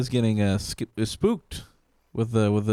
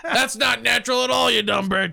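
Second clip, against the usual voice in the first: mocking director; 11 semitones higher than usual. Mock director yelling at actress.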